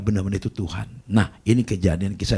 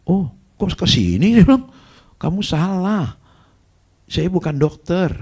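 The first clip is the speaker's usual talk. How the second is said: surprised protest; 9 semitones higher, 5 dB louder, slower than usual. Retold or imitated. Husband surprised, then protesting.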